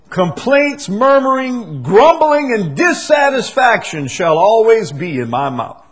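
a whining parody of the grumbler; mock complainer. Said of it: "I think many say".

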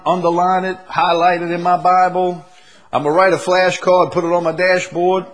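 loud, high, hammed-up zealous believer; mock eager churchgoer. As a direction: gush like a churchgoer promising everything. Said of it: "you can say".

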